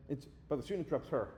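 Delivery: normal talking voice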